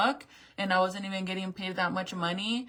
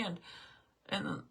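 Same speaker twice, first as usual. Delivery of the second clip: ordinary delivery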